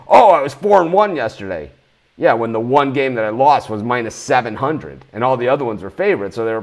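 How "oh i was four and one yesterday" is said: smug boast, pitched up and louder; mock a bragging tout; mocking quote. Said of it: "One thing I don't do is say".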